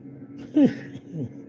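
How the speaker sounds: a high breathy giggle, no words; high airy laugh. Real, not acted. Laughing to himself on his live stream, high and airy. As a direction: let a short airy laugh slip out.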